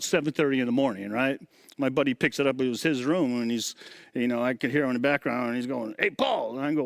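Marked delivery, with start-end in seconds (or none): 6.0-6.6 calling out. Retold or imitated. Buddy calling from across the room.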